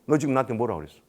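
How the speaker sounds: normal talking voice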